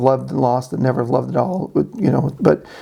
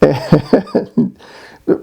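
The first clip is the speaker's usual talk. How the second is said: ordinary talk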